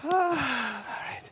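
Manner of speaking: sighing, winded answer; breathless after the entrance; out of breath. Real, not acted. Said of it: "You're winded".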